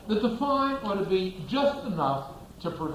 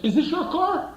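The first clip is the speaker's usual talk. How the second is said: high, sharp cop question; a cop barking at the driver; stern cop; 12 semitones higher, 5 dB louder than usual. Retold or imitated. Cop confronting the driver about his car, high and loud. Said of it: "suppose the cop says".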